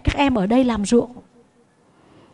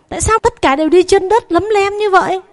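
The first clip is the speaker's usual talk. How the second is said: high, fast, dismayed exclamation; shocked question; 9 semitones higher, faster than usual. Acted. Son home from the city asking why his family is barefoot, shocked high question. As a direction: blurt it out in disbelief.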